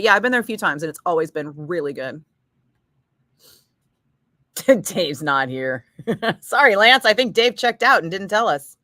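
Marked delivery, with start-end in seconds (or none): 4.8-5.9 mock stoner line. Joking quote as absent caller.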